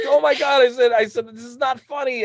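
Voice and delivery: put-on high, loud indignant child; upset kid. Boy protesting to his laughing mom, high and indignant. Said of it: "Yeah, I'm like".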